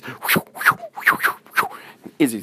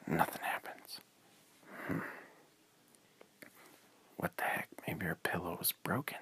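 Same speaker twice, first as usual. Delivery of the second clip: softer whispered puzzling; hushed whisper; 2 semitones lower, 14 dB quieter than usual. A narrator's reading. Narrator musing in a whisper that the pillow broke. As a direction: wonder aloud in a whisper.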